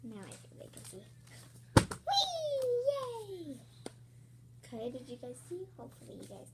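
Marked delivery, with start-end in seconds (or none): 2.1-3.6 laughing whee. Real, not acted. Flip with a laugh.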